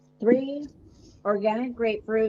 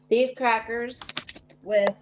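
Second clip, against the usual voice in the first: chewing while talking; 2 semitones higher, much slower than usual. Real, not acted. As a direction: mouth full of crackers. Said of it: "You can hear me eating".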